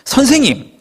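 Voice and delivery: high, exasperated mock appeal; irritated address. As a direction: call the teacher in annoyed protest. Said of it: "여기서부터 짜증이 느껴지지 않으세요".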